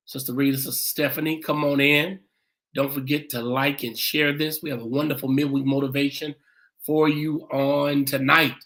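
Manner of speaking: normal talking voice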